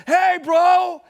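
big, high, loud "Hey, bro."; loud casual greeting. Retold or imitated. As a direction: boom out a cheerful buddy greeting, imitating the caller. Pastor on phone greets him.

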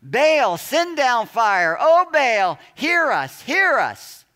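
shrieked high and loud, the name stretched out; wild shrieks. Retold or imitated. Prophets of Baal begging their god for fire, wild shrieking. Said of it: "call out in wild shrieks".

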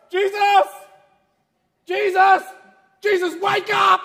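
high, loud, panicked shouts; panicked calling. Acted. Man in panic trying to wake Jesus, shouting. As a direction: yell to wake him in terror. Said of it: "Guy goes".

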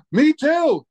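a thrilled fan blurting it out high; excited fan. Retold or imitated. Front-row fan interrupting the comic, gasping and high. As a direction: gasp then a high, squealed me too. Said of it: "he got all excited".

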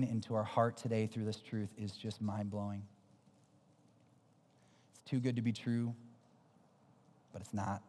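low, hushed praying voice; subdued, reverent address to God; closing prayer. Real, not acted. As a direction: pray gently, with pauses between phrases.

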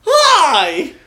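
high, loud whale voice. Acted. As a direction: boom it out like a whale. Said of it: "do the whale voice".

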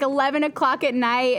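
ordinary talk, nothing marked

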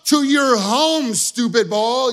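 mock furious shout at an object; yelling. Angry golfer yelling at the ball, loud and exasperated. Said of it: "I'm, like, yelling at my ball".

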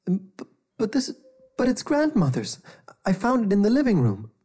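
put-on high, stammering boy voice; boy protesting. A narrator's reading. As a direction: protest like a scared kid. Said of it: "Jeremiah protested".

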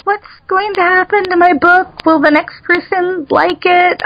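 high-pitched fretful question; anxious wondering. Retold or imitated. Author fretting over the new editor, anxious and high. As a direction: voice your own nerves, pitched high. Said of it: "like, on tenterhooks".

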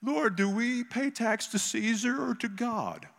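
high, slow mock Pharisee whine. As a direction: draw it out as a high-pitched, hammed-up Pharisee. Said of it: "I have a Pharisee voice".

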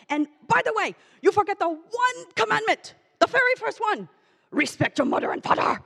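furious father ranting down the phone in broken English; yelling dad, broken English. Retold or imitated. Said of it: "he's yelling at me".

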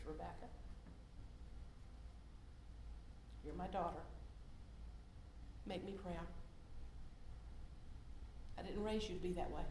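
soft, hushed voice of a disappointed father; hushed inner voice of reproach; whispering. Retold or imitated. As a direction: drop to a near whisper, ending fully unvoiced. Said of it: "he whispers".

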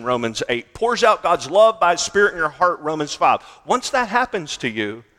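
loud, driving sermon list of verses; preaching at full force. Acted. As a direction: hammer each proof text home. Preacher listing scripture proofs.

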